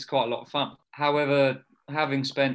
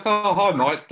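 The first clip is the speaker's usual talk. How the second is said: playing along; 6 semitones higher, 8 dB louder, slower than usual. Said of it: "like, played along".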